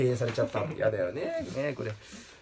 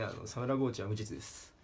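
"サムラゴーチは無実です" murmured low and soft; quiet aside; 6 semitones lower, 11 dB quieter than usual. Shown as a demonstration. Comedian quietly mutters the joke line, softer and lower. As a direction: drop "サムラゴーチは無実です" to a low hush.

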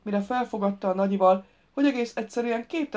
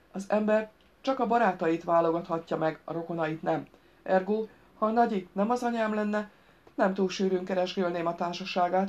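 usual speaking voice

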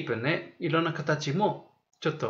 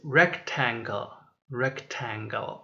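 slow model word; 4 semitones lower, 4 dB quieter, much slower than usual. Shown as a demonstration. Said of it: "teacher models new word slowly".

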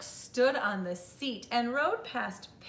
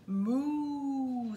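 drawn-out, higher-pitched moo; cow moo; 4 semitones higher than usual. A narrator's reading. Cow answering Duck as he rides past, long moo. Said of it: "said Cow".

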